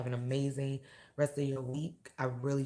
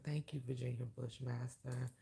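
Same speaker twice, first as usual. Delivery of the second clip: normal talking voice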